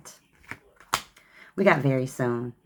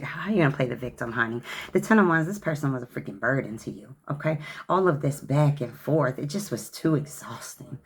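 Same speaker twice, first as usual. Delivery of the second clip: usual speaking voice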